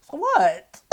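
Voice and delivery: loud, high, mock-incredulous; incredulous comeback. Answering older man who's very traditional, incredulous comeback. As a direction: pitch it up in mock disbelief. Retold reply to old head.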